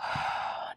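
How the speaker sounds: quiet, released breath out; soft exhale sigh. Shown as a demonstration. Teacher modelling a release of breath, soft sigh. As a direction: sigh it out softly. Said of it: "let it go".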